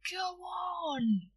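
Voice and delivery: high, pleading go on; coaxing plea. Acted. Boy begging shopkeeper for credit, high coaxing plea.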